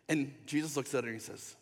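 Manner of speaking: ordinary talk, nothing marked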